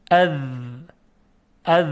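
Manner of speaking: اذ with a long buzzing tongue-tip th; dhal sound demonstrated. Shown as a demonstration. Teacher showing how to pronounce dhal, held buzz on اذ. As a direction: hold the voiced th at the end of اذ. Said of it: "این حرف رو تلفظ کنیم".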